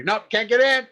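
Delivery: high, loud mock refusal; hammed-up guard calling out an alarm; border guard. Acting border guard refusing entry.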